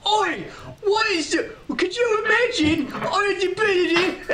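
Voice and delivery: mock a terrible put-on British accent, squealing; Paige impression. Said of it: "She just goes".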